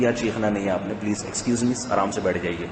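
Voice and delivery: soft, low, polite manager voice; a hushed, courteous office manager asking for calm. Retold or imitated. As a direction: soothe the staff softly like a calm boss. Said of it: "کتنے آرام سے بات کرتے ہیں".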